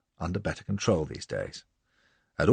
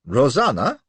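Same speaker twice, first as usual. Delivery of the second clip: Rosanna rising, louder and questioning; teasing question; 6 semitones higher, 8 dB louder than usual. A narrator's reading. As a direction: echo Rosanna as a sly question. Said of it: "what's this, a pet name".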